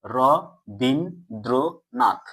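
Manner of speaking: রবীন্দ্রনাথ very slowly, split as র, বীন, দ্র, নাথ; syllable by syllable. Shown as a demonstration. Teacher shows students the syllables, sounding out রবীন্দ্রনাথ very slowly. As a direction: break রবীন্দ্রনাথ into four drawn-out syllables with gaps. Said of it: "এটাই তো আমরা উচ্চারণ করছি".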